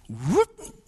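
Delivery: rising "whoop" swoosh; imitated whoop. Speaker imitates whipping the car around, rising whoop. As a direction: swoop up like a quick swerve.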